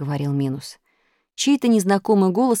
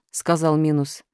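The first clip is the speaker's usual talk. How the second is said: plain reading voice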